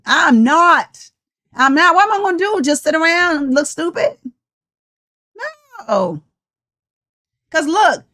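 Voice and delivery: normal talking voice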